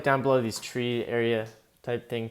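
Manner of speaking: normal talking voice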